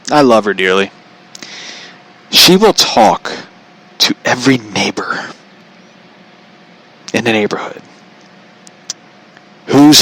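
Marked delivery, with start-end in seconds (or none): none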